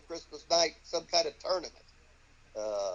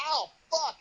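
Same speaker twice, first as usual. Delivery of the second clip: high yelp of retold pain; mock pain yelp; 11 semitones higher, 3 dB louder than usual. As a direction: yelp as if the punch really landed. Retold being hit hard.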